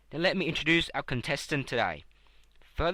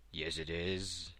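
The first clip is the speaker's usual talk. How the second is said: lower, softer male contestant; 9 semitones lower, 10 dB quieter, slower than usual. Acted. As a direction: drop low and hesitant. Host voices contestant Mo.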